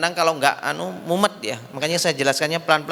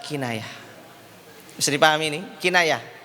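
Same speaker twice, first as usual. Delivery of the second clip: normal talking voice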